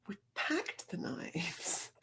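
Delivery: sheepish whispered admission. Retold or imitated. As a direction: whisper it, a little ashamed. Nervous reply to snake catcher.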